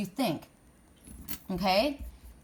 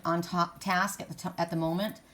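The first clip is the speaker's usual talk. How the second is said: ordinary talk, nothing marked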